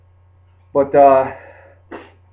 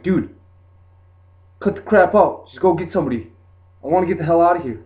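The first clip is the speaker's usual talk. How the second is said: louder, higher irritated outburst; angry retort; 5 semitones higher, 4 dB louder than usual. Retold or imitated. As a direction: snap at him to cut it out, fed up.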